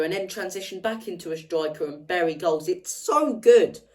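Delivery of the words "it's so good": drawn-out gushing. Real, not acted. Gushing over Harry Kane.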